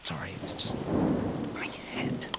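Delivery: fading to a pained whisper; barely breathed, head aching; weak whisper. Acted. Pijnlijk hoofd na ontwaken.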